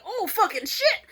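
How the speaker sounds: high-pitched startled cry; retold shocked exclamation; crowd in shock.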